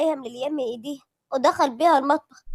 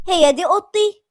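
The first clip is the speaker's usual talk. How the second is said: raised, uneasy question; apprehensive Lilian; 5 semitones higher, 5 dB louder than usual. A narrator's reading. Wife eyes a strange bedroom, asking apprehensively. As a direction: ask fearfully, pitch lifted. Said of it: "وقالت بهدوء وخوف".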